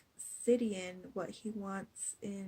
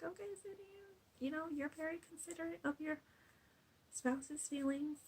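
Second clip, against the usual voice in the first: mock gushing praise; 7 semitones higher, 4 dB quieter than usual. Teasing quote of herself to Sidian.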